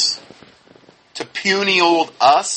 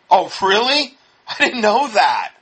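higher, sarcastic feigned amazement; mock surprise; 5 semitones higher than usual. Critic answering God and Adam looking alike, sarcastic surprise. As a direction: pretend this is news to you. Sarcastic reply to absurd quote.

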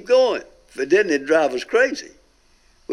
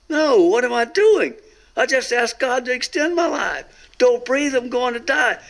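higher-pitched urgent cry, not much louder; a half-voiced scream of panic pitched high; inner scream; 8 semitones higher than usual. Preacher reliving his spirit's cry not to die, high and urgent. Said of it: "as though I screamed as loud as I could".

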